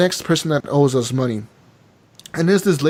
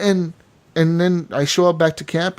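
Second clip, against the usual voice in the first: usual speaking voice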